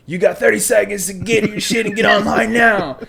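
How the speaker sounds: high, loud barked command; drill instructor yelling. Retold or imitated. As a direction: bark the countdown at recruits. Drill instructor counting recruits down.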